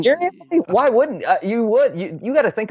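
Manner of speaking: really, you think that?; incredulous. Real, not acted. Pushing back on co-host.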